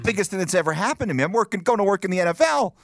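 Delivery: high, fast gushing; mock excited news. Son faking dream job to mom, high and fast gushing. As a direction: rush it out like the best news ever.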